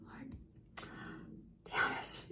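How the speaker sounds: breathy, muttered damn it; whispered curse. Real, not acted. Recalling her anger, cursing in a hush. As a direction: curse it under your breath.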